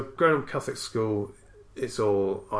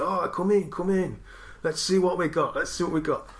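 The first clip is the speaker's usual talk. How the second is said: high, louder, eager welcoming dad; friend's Italian dad; 8 semitones higher, 4 dB louder than usual. Retold or imitated. Friend's dad welcoming boy borrowing films, Italian dad impression. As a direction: play the welcoming dad, pitched well up. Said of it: "his dad's like, well, he's a nice guy".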